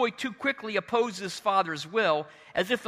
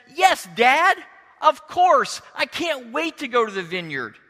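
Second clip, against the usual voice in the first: higher, louder eager yes, easing off at the end; enthusiastic; 4 semitones higher, 6 dB louder than usual. Acted. Second son answering his dad, enthusiastic. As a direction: play the eager-to-please son. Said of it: "This son is so enthusiastic".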